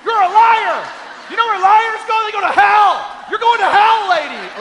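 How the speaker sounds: screaming high and loud at the liar; imagined furious shout. Pretend. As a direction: yell it across the plane like an accusation. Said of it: "I just wanted to get up and be like".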